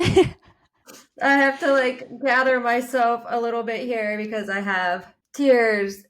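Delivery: pushing words out through real tears. Real, not acted. Coach moved to tears by her client's story, tearful and high. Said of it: "Oh, I'm crying".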